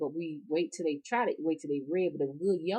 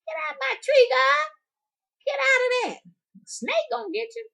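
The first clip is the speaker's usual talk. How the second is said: granny yelling; 9 dB louder than usual. Retold or imitated. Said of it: "She like".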